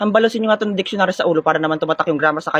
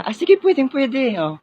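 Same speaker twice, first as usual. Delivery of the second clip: higher and slow, smitten; charmed and eager; 6 semitones higher, slower than usual. Acted. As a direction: melt for the pretty girl, drawn out. Guard charmed by pretty girl. (kinikilig at mabagal na pagsang-ayon)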